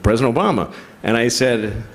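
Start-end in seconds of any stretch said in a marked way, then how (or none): 0.0-0.7 lower-pitched, lightly voiced president; a hint of presidential phone greeting; Obama. President calls a comedian out of nowhere, lightly impersonated. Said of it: "It sounded like the president".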